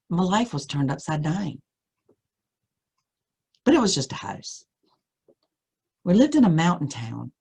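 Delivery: plain reading voice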